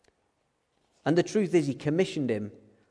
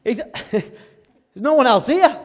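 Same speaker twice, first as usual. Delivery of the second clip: higher, louder startled disbelief; startled Moses; 9 semitones higher, 10 dB louder than usual. Retold or imitated. Moses startled that God picks him, high and loud. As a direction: glance round in disbelief.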